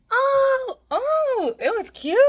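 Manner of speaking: high, loud delighted squeal; excited squeal. Real, not acted. Seeing cake, squealing.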